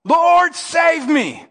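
loud, high cry for rescue. Acted. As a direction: cry out to the Lord as you sink. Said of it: "he cries out".

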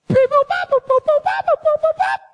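high, steady beeping syllables; tone-dial beeps. Retold or imitated. Boy dialing Lindsay's number, mimicking the high keypad beeps. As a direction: sing the dial tones high and flat. Imitating phone dial tones.

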